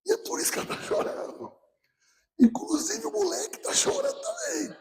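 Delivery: high, breathy half-laughing whisper; hushed confession. Real, not acted. Pastor whispering to the congregation that the boy cried too. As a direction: confess it under your breath, almost crying.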